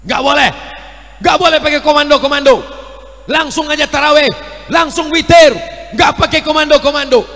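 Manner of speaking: high, loud, rapid protest; brusque, shouted prohibition; scolding objector. Acted. Voices an objector banning the calls.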